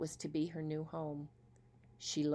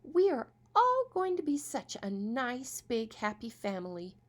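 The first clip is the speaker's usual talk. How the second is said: cheerful stepmother; 7 semitones higher, 4 dB louder than usual. Acted. Stepmother promises happy family.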